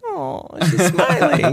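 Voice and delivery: high, drawn-out fake fright; hammed-up terror, half laughing; mock scared. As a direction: act scared through your laughter. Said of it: "had to start acting scared".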